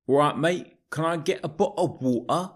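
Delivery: high, fast American's mock British voice; bad British accent. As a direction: [name] hamming up a British accent. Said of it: "are you in England, [name]?".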